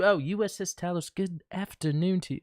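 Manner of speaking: playful put-on English accent on a greeting. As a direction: greet them like a cheery Brit. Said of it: "now I'll talk with an English accent".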